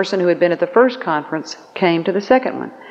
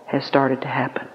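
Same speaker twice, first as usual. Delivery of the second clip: whispered; 5 semitones lower, 7 dB quieter than usual. Retold or imitated. Husband tells wife of daughter's miracle.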